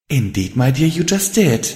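playful, lifted character voice; hint of mirth. A narrator's reading. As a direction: confirm it teasingly. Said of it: "hint of mirth in her voice".

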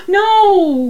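gasping high "No!"; mock horror at the idea; shocked gasp. Mock outrage at Moana dying.

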